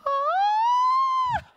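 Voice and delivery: very high, loud scream; imitated shriek. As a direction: mimic a startled cashier's piercing shriek. Cashier shrieks at the roaring.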